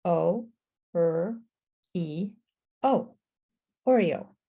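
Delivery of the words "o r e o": "O, er, E, O" with a pause after each; four separate sounds, "O, er, E, O"; sounded out. Shown as a demonstration. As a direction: segment "O, er, E, O" for a learner. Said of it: "How many sounds do you hear".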